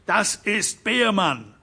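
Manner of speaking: put-on the young man cries out the name; shout. A narrator's reading. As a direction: call the name out in alarm. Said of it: "schrie Howan Derrick".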